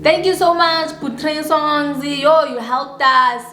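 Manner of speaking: high, slowing singsong gratitude; gushing thanks. Retold or imitated. Thanking him for helping them out, effusive retold thanks.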